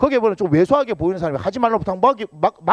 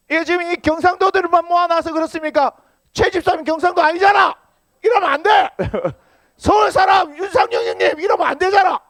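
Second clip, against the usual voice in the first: teasing scold; 10 semitones higher, 4 dB louder than usual. Acted. Teasing scolding of named brothers.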